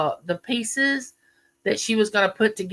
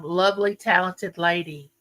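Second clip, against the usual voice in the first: usual speaking voice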